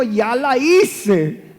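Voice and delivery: put-on slow, high, drawn-out child's glee; gleeful child. Son gloating he will get rich off his father. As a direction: savor it like a kid who just struck it rich. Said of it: "Él dijo".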